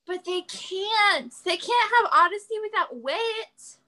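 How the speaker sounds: high, drawn-out dismayed objection; stretched, high-pitched objection; pleading protest. Real, not acted. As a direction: protest slowly, pitch climbing in disbelief. Protesting that Wit can't go.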